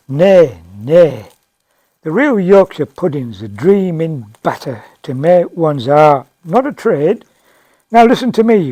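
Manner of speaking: loud, indignant lecturing; emphatic correction. Acted. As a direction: set the waitress straight at full volume. Diner loudly corrects the waitress.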